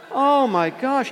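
slow, high-pitched appalled cry; hammed-up horrified reaction; mock horror. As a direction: draw it out in comic dread. Horrified, no longer hearing them.

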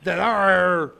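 loud, slow wrestler announcement; wrestling promo voice. Acted. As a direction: boom it like a pro wrestler. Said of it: "I thought he was doing a wrestling thing".

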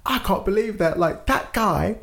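high, slow, laughing disbelief; imitating a tipsy girl in shock. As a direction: play her, stunned and giggly. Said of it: "She's like".

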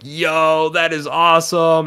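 hyped-up retold compliment; excited admiration. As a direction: imitate a stranger blown away by a shirt. Retelling a stranger admiring his shirt.

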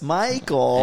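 imitating a whiny bus driver. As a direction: stretch the name out in a whiny voice. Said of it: "that had a whiny voice".

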